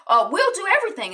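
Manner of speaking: put-on a high, fast little-boy promise; eager kid voice. Young Kevin eagerly promises his dad, high and quick. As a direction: chirp it out like an excited ten-year-old. Ten-year-old Kev voiced.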